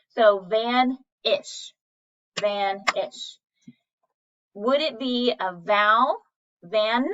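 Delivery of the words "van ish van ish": van-ish, each syllable slow and apart; slow syllable split. Shown as a demonstration. Teacher breaks a word into syllables, very slowly. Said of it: "take a look at this next one".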